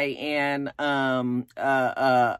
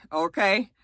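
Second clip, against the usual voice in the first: ordinary delivery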